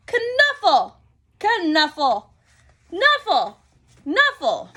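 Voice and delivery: loud, high, insistent shouting match; arguing kids. Acted. Two girls fighting over how to say it, loud and insistent. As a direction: bicker back and forth.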